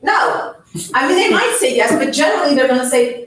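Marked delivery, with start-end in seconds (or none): 0.0-0.6 high unvoiced flat no; curt patient refusal. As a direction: snap back a refusal like a stubborn patient. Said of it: "They will typically say".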